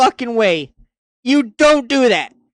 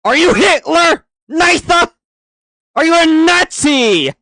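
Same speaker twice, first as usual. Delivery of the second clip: mock outraged shouting; 6 semitones higher, 4 dB louder than usual.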